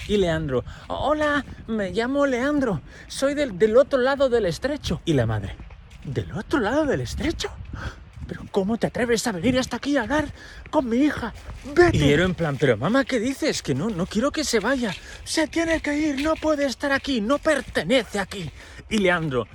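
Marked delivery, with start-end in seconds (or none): 0.9-5.0 high, polite nervous greeting; nervous Leandro. Retold or imitated. Leandro introducing himself to Ero's mother, high and nervous. 6.0-12.0 high, incredulous and indignant; be appalled at the stranger; outraged mother. Retold or imitated. Mother outraged at the boy from across the strait, high. 12.6-18.5 put-on high, protesting girl's voice; pleading daughter. Ero protesting to her mother, high and pleading.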